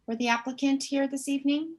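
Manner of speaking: usual speaking voice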